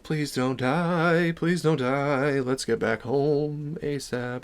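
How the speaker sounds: soft, slow nervous murmur to yourself; hushed frightened self-talk; scared inner plea. Retold or imitated. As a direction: pray under your breath, slowly. Nervous inner thoughts in woods.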